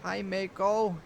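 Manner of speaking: deep New England uncle greeting; a gruff New England hello, called out; a loud New England hello to the girl; New England accent. A narrator's reading. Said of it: "with his deep New England accent".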